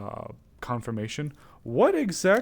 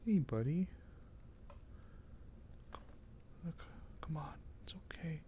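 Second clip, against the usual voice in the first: softer, hushed, dropping to a whisper at times; soft soothing coax; 3 semitones higher, 13 dB quieter than usual. Real, not acted. Luring the dust bunny out, hushed soothing voice. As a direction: soothe a frightened animal, barely above a breath. Soothing skittish creature softly.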